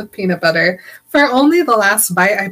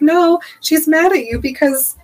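indignant retort; 6 semitones higher than usual. Real, not acted.

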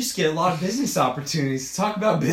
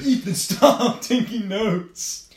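mock complaint; 6 semitones higher, much slower than usual. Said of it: "He's like".

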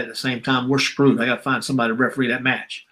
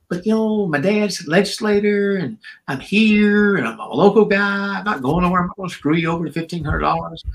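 pleading; 6 semitones higher than usual. Retold or imitated. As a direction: plead your case to the boss, voice up. Pleading with boss for loan.

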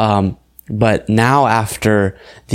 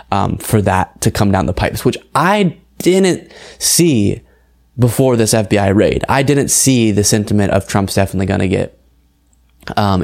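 normal talking voice

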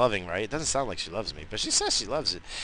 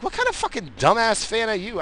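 indignant; 13 semitones higher, 4 dB louder than usual. Real, not acted. Indignant rant.

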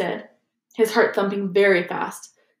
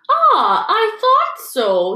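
much higher, slow, knowing drawl; knowing Lockhart; 13 semitones higher, 6 dB louder, much slower than usual. Acted. Lockhart to Dumbledore, high smug knowing drawl. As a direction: pretend you saw it coming.

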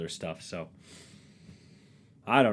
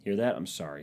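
ordinary delivery

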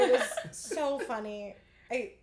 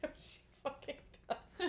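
laughing; 4 semitones higher, 10 dB quieter than usual. Real, not acted. Laughing denial of joke.

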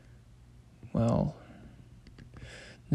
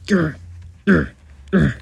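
loud short monster growls; zombie growl; 4 semitones higher, 6 dB louder than usual. Acted. Turning into a zombie.

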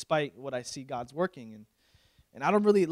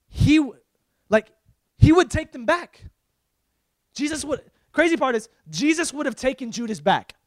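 loud, high exclamation; emphatic preaching shout; 10 semitones higher, 10 dB louder than usual. Real, not acted. Preacher telling listeners Jesus would take Judas back, emphatic shout. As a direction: shout it out in amazement.

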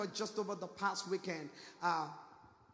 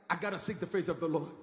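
low, quick pious excuse; mock pious excuse; 4 semitones lower, faster than usual. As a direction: imitate a stubborn churchgoer, low and hurried. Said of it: "They're just stubborn".

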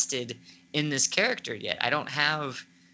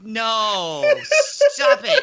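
high, loud cries of protest; mock disbelief; 6 semitones higher, 9 dB louder, much slower than usual. Podcaster replaying their shock at the twist, mock disbelief. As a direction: act out an exaggerated, stunned refusal.

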